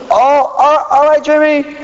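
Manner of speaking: a hint of a flustered mom; mom's voice.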